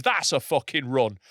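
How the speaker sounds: usual speaking voice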